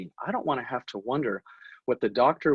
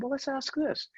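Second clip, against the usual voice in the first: high mock voice; 13 semitones higher, 5 dB quieter than usual. Retelling team's idea.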